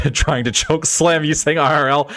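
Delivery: ordinary talk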